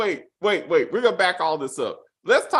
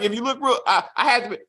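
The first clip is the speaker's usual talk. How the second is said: usual speaking voice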